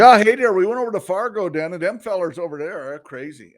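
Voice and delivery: put-on high, loud drunken Minnesotan; drunk Minnesota accent. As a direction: slur it like a tipsy Minnesotan. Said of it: "it's obviously Nick's been drinking".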